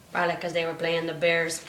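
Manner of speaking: usual speaking voice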